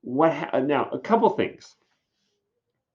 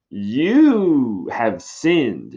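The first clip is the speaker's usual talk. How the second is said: drawn-out, louder pronouncement of guilt; mock condemnation; 3 semitones higher, 5 dB louder, much slower than usual. Friar passing judgment on Juliet, mock condemnation. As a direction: draw it out like a solemn accuser.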